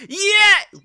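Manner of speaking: loud high cheer; crowd cheer. Retold or imitated. A whole nation cheering, high and loud. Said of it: "the whole of America goes".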